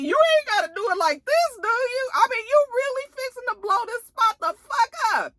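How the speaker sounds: gleeful high-pitched disbelief; scandalized glee. Real, not acted. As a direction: squeal at the coming drama. Narrator's own excited aside.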